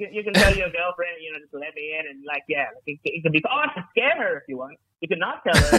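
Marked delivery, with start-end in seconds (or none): none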